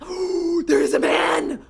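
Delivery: gasp, then a loud shocked shout; real fright, loud and high; startled cry. Real, not acted. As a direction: jump at the sight of a man. Sudden scare in game.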